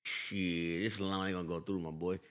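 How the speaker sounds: low, quiet street-talking banker. Pretend. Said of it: "talk how I grew up talking".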